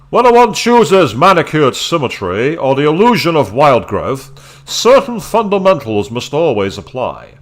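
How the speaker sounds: put-on grand, measured reading of an old text; formal reading voice. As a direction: read it like a stately garden guide. Reading garden book text aloud.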